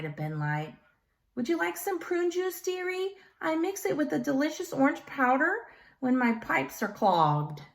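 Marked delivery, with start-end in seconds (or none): 1.4-7.6 put-on high, lilting little old lady; sweet old woman. A narrator's reading. Miss Zaluski offering Carter prune juice, high sweet granny voice. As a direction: coo it like a doting granny.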